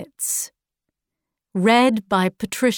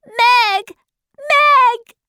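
put-on very high, loud child's cry; 12 semitones higher, 5 dB louder than usual. A narrator's reading. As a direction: call the name high, climbing on the second.

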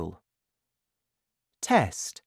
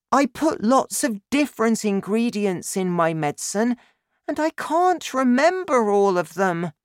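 put-on high-pitched child's voice, rising higher; young son explaining; 11 semitones higher, 4 dB louder than usual. A narrator's reading. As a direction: confess the snag to Dad. Boy confesses problem to father.

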